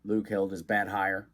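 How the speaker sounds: usual speaking voice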